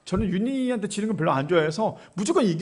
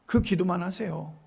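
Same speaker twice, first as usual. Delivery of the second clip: usual speaking voice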